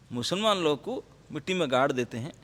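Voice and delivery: normal talking voice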